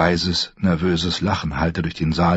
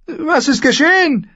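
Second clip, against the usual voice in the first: very high, loud anxious question; alarmed woman; 5 dB louder than usual. A narrator's reading. Lady in the besieged hall asks for news, alarmed. Frightened guest demands news.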